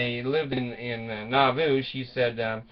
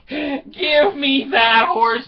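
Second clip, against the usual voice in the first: very high, slow, loud cry; hammed-up insistent demand; 11 semitones higher, 6 dB louder, much slower than usual. Wife repeating her escape demand, slow high mock yell. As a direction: stretch every word in mock desperation.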